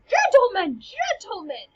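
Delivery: high, loud mock protest; crowd calling out. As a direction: call out to break up a fight. Everybody calls out to the fighters.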